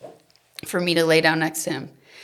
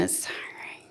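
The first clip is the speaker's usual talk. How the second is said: a worn-out whisper, much softer; tired whisper; 13 dB quieter than usual. Real, not acted. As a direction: let exhaustion drain the voice to a whisper. Weary confession about parenting.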